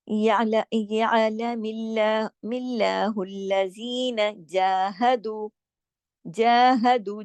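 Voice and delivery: slow, chanted tajweed reading; Quranic recitation. Acted. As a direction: intone the verse, slow and measured. Recites verse slowly.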